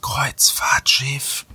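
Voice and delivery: slow breathy whisper. Pretend. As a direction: whisper it like a secret clue. Teasing hint during the podcast.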